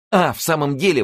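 high, loud cry of relief; joyful exclamation. A narrator's reading. Youth hearing a letter came, excited exclamation. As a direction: exclaim it with sudden hope, pitched well up. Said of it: "воскликнул Рауль".

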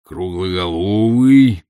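slow, drawn-out appraisal; muttering. A narrator's reading. Professor classifying his visitor aloud, muttering. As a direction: draw the word out while sizing someone up. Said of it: "забормотал он".

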